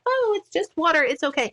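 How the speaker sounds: high, breezy happy-mom voice; mock happy mommy. Mocking a calm mother after spilled bath water, high and breezy. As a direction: play the unbothered perfect mom. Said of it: "There's no happy mommy".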